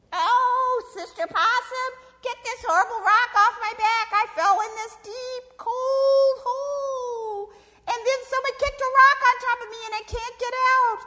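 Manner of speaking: loud, high, wailing complaint; pleading snake. Acted. Snake under a rock begging possum, loud high wail. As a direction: whine pitifully at full volume, very high.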